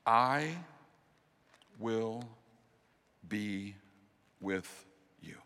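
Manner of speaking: soft, slow, reassuring promise with long pauses; tender whispered-close reassurance; comforting. Acted. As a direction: hush and lay each word down gently. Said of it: "comforting, reassuring words".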